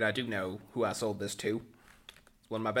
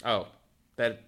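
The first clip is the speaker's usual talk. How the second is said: ordinary delivery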